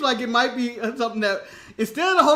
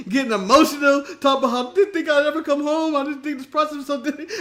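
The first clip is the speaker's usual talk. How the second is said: normal talking voice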